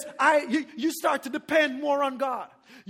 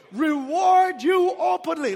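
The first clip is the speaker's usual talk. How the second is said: emphatic proclamation; 3 semitones higher, 7 dB louder, slower than usual. Real, not acted. Preacher's climax line.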